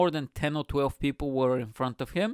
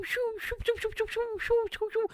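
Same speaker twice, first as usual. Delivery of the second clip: high whooshing noises. Retold or imitated. Cyclists whooshing past the sidelines.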